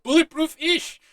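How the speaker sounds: squeaky high, loud sheepish backtrack; Alexei, sheepish. Retold or imitated. Alexei answering Walker, high loud sheepish backtrack. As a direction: push it up high and loud, caught out.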